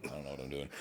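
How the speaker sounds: mock quiet confession; sheepish aside. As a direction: drop the bravado and mumble it. Fake confidence, then admission.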